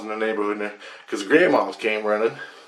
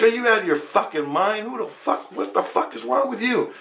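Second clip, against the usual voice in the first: high-pitched, outraged retort; incredulous outrage; livid; 11 semitones higher than usual. Retold or imitated. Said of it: "livid because I can't believe".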